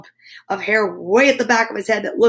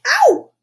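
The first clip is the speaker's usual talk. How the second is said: sharp very high louder ow; high yelp of pain; 5 dB louder than usual. Acted. Oliver yelping as Mom yanks the brush. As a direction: yelp as the hair is yanked.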